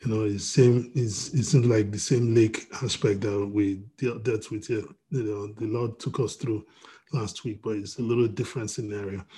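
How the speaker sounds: normal talking voice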